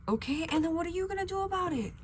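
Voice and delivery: high-pitched, mock-indignant challenge; retold confrontation. Retelling how she confronted the other woman, high mock indignation. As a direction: throw it out high, acting out the quarrel.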